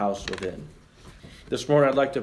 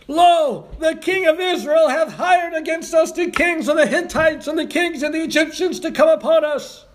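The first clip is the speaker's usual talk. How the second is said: high, loud panicked cry; alarmed Syrians; 14 semitones higher, 4 dB louder than usual. Acted. Syrian soldiers warning each other of the army, panicked high cry.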